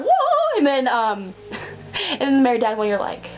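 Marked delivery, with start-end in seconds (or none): 0.0-0.6 very high, loud drawn-out whoa; hammed-up wail imitating a sudden transformation; mock "whoa". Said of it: "and then you're like".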